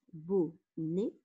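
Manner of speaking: "bonnet" slowly in two beats, bo-nnet; split into syllables. Shown as a demonstration. Teacher counting syllables for pupils, "bonnet" split as bo-nnet. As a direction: sound out "bonnet", pausing between bo and nnet. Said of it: "deux syllabes".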